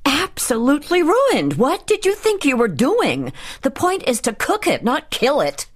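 high-pitched, furious scolding; exasperated high tirade; angry yelling. A narrator's reading. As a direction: tear into the cook, pitch way up. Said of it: "she couldn't help yelling".

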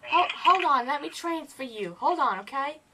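high put-on caller voice; squeaky prank-call voice. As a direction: pitch up into a silly fake persona.

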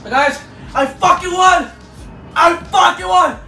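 loud, slow, triumphant yell; excited victory shout. Real, not acted. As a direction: shout it like you just won. Victory after winning challenge.

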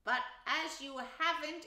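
high, loud interruption; rather unkind. A narrator's reading. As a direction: cut in sharply as an impatient Rat. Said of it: "interrupted the rat rather unkindly".

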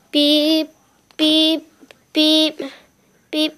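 imitated alarm beeping. Child plays her alarm going off, mock electronic beeping. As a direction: beep like an alarm clock.

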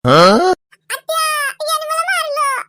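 put-on high, drawn-out child's complaint; tattling child. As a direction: wail for auntie like a wronged child.

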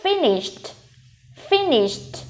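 finished ending in a crisp t after sh; final t sound. Shown as a demonstration. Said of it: "đuôi e d sẽ được phiên âm là t". (finished có âm t ở cuối)

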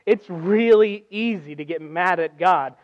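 ordinary talk

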